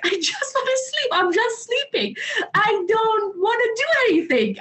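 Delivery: put-on high, whining like a tired child; mock whine to be left alone; whiny sleepy kid. Retold whiny protest at father.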